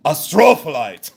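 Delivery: "astrophyllite" boomed as uh-STROOO-fuh-lite; imitated yell. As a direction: mimic a man yelling "astrophyllite", leaning hard on STRO. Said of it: "hear it one more time".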